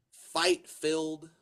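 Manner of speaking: normal talking voice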